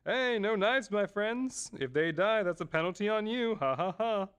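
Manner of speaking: high, showy announcer with a fake laugh; playful game host. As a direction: call out the rules like a gleeful host. Acts out game host in story.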